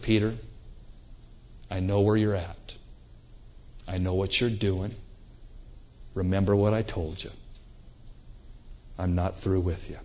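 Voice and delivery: quiet, soft reassurance to a friend; a quietly reassuring voice for Peter; tender Jesus. Acted. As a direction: say it low and gentle, full of grace. Jesus's loving look at Peter.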